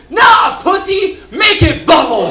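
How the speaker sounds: high, loud taunting yell; rowdy shout. Retold or imitated. Drunk dad urging the kid to chug, loud high taunting yell. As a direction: egg the kid on like a drunk coach. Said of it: "slaps him on the back and goes".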